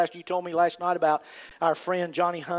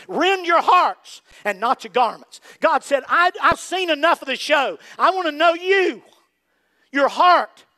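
higher, louder voice of God pleading; preaching at full force; 9 semitones higher, 7 dB louder, slower than usual. Acted. Preacher voicing God calling the church to break it up, loud and raised. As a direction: cry out God's demand for the heart.

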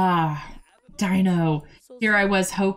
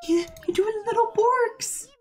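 much higher cooing at the dog; very high pet-talk; 14 semitones higher than usual. Real, not acted. Talking to her dog.

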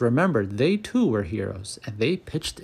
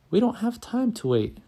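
urgent call; 10 semitones higher than usual. A narrator's reading. Gertie answering her crime-fighting partner, mild urgent shout. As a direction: call back urgently, pitch jumping up. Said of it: "Gertie shouted back".

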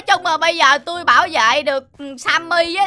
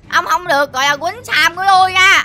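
ordinary talk, nothing marked

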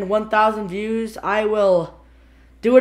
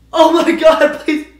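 loud desperate plea; 7 semitones higher, 11 dB louder, much slower than usual. Real, not acted. Mouth burning, pleading.

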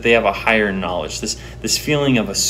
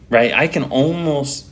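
usual speaking voice

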